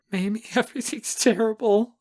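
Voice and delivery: high, sobbing whimper. A narrator's reading. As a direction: whimper it to a friend through tears. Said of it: "I whimper".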